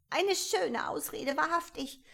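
pigeon, scornful; deep contempt. A narrator's reading. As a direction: scoff at her. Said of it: "im Tone tiefster Verachtung".

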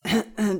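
short, loud throat clear. A narrator's reading. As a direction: clear the throat before explaining. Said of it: "Er räusperte sich". (Räuspern)

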